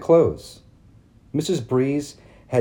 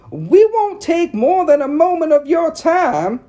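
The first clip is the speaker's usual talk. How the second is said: high, loud prissy society matron; prim town lady; 5 dB louder than usual. A narrator's reading. Narrator voicing Missus Cady calling on Mama, high and prim. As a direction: lift it up into a fussy church lady. Ladies voiced in read story.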